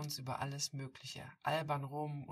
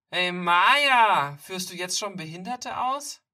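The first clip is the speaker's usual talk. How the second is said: loud mocking bully; 4 semitones higher, 18 dB louder, slower than usual. Bully taunting in the park.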